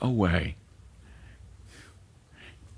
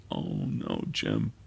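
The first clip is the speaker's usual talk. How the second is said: tender widow's comfort; 10 semitones lower, 5 dB quieter, slower than usual. Retold or imitated. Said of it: "embraced me and said".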